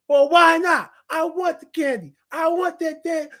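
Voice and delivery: put-on whiny kid. Kid at the shelf demanding candy, high and whiny. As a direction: whine like a kid denied candy. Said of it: "And they go".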